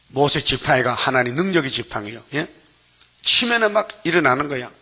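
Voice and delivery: ordinary talk, nothing marked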